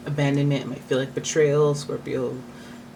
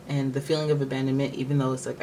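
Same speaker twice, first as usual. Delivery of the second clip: normal talking voice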